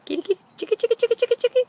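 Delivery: high, loud sing-song call; calling chickens. Real, not acted. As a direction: chant it to call the hens in. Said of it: "So I said kitty, kitty, but I meant chicky".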